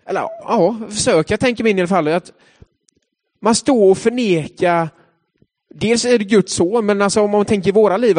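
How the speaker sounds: ordinary talk, nothing marked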